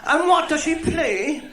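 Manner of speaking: put-on high, slow calling old woman; old Cork woman. Old woman calling to her friend over the partition, high and slow. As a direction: call over the toilet partition. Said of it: "did the other one".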